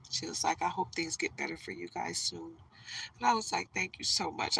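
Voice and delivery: soft, holding back tears; hushed and moved; choked up. Real, not acted. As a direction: say it very quietly through a tight throat. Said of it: "trying not to get choked up".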